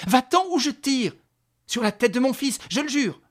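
loud, high, urgent shout; shouted threat. Acted. Mother with revolver swears to fire, shouting. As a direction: swear it at full voice.

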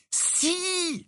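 high, thin, delighted cry; exclamation. A narrator's reading. Harry exclaiming that it is true, amazed. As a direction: draw it out high without volume. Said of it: "s'exclama Harry".

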